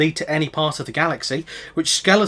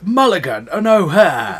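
comic catchphrase voice; 4 semitones higher, 4 dB louder, slower than usual. Retold or imitated. Quoting comedy catchphrase.

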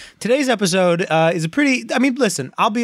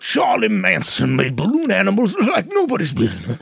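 mystery host voice; 3 semitones higher than usual. Pretend. Murder mystery host role.

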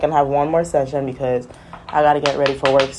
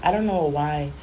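usual speaking voice

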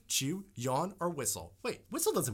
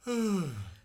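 imitated sigh; 5 semitones higher than usual. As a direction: sigh like the yawner does. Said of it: "they go like this".

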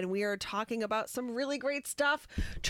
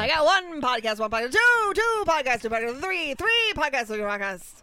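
loud, fast auctioneer patter; 3 semitones higher, 7 dB louder than usual. Retold or imitated. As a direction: rattle off bids like an auctioneer. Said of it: "That's what you sound like".

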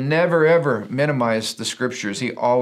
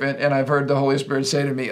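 ordinary talk, nothing marked

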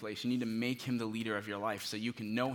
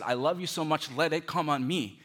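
louder, ringing voice of love; passionate declaration; 4 semitones higher, 8 dB louder than usual. Acted. Preacher voicing Jesus to the congregation, loud and passionate. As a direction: proclaim it with full heart.

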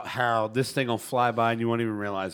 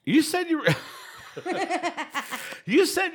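laughing. Real, not acted. Cracks up mid-sentence.

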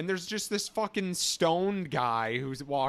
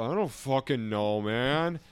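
lightly voiced stoner mumble; a hint of a blasted stoner; slower than usual. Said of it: "I just hear him go".

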